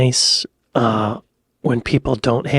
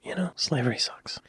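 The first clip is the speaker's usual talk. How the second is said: whisper; 9 dB quieter than usual. Real, not acted. Quiet aside after clip.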